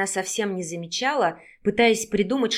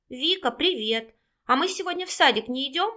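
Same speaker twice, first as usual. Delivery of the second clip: put-on higher-pitched small boy's voice; little child; 5 semitones higher than usual. A narrator's reading. Little brother asks Vika about kindergarten, in a child's voice. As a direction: pipe up like a sleepy little brother.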